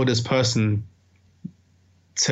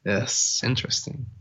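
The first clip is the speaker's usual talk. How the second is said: quiet musing; 7 dB quieter than usual. Real, not acted. Musing repeated word.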